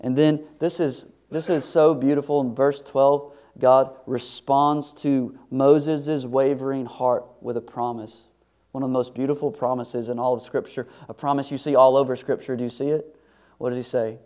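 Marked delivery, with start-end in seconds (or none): none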